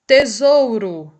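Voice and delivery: "Tesouro" slow and loud, ending in a U sound; final O as U. Shown as a demonstration. Teacher demonstrating the final letter to students, "Tesouro" ending in u. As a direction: draw out "Tesouro" as te-zou-ru. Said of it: "é pronunciado com o som de".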